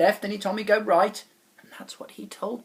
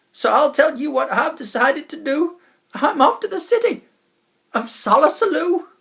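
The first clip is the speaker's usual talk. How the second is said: loud, higher, hearty salesman voice; jolly wobble chap; 10 semitones higher, 11 dB louder than usual. Acted. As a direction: sell the dream of Solosaloo.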